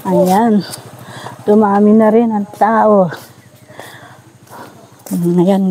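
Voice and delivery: ordinary talk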